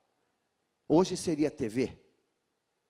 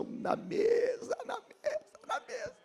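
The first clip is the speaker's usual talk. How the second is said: cried out; 4 dB quieter than usual. Real, not acted. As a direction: wail it out, voice cracking. Preacher cries out at climax. (clamor agudo e lento, a voz falhando)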